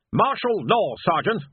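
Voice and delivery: curt captain's snap; snapped. A narrator's reading. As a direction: snap it at him like an officer. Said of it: "snapped Rust".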